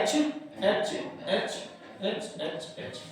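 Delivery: whispered counting. Real, not acted. Teacher counting atoms on the board, whispered. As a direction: count the letters under your breath.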